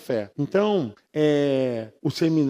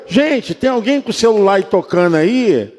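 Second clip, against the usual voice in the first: louder call across the church hall; 3 semitones higher, 9 dB louder than usual. Retold or imitated. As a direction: call out to the whole room. Calls out to congregation.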